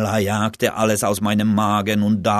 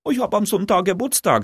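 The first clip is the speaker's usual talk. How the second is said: high, fast and eager; excited announcement; 11 semitones higher, faster than usual. Acted. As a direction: blurt it out with glee. Friend announces birthday party.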